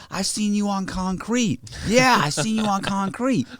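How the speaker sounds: high, slow imitation of an admirer; imitated starstruck bro, drawn out; excited fan. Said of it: "He said".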